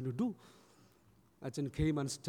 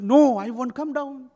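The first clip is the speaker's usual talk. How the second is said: high, loud, drawn-out refusal; adamant refusal; 8 semitones higher, 11 dB louder, slower than usual. Retold or imitated. Boy refusing the neighbours from the treetop, adamant. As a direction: refuse loudly, stretching it out. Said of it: "He was so adamant".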